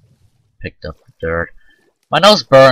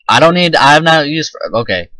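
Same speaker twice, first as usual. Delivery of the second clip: ordinary delivery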